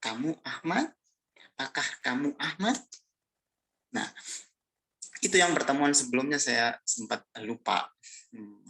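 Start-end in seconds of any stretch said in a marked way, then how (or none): 0.0-2.8 KAMU AHMAD twice, each rising on AHMAD; question intonation. Shown as a demonstration. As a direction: ask it, lifting the end of 'Ahmad'. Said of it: "Sekarang intonasi bertanyanya". (intonasi bertanya, 'Ahmad' naik di akhir)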